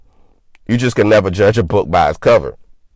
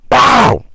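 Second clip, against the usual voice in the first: sharp, much higher shouted "Bow!" mimicking a slap; slap sound; 5 dB louder than usual. Acting out slapping his partner, sharp slap noise. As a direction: bark out the crack of a slap.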